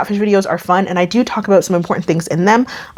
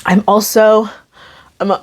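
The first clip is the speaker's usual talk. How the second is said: normal talking voice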